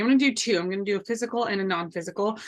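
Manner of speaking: ordinary delivery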